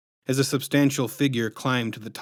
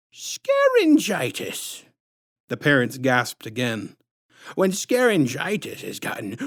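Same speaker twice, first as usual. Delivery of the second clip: sickly sweet menace, drawn out; syrupy sinister clown woman introducing herself; creepy clown lady; slower than usual. A narrator's reading.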